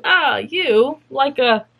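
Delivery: high, playful cartoonish lilt; jokey voice climbing high at the end; silly put-on voice. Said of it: "what's that voice?".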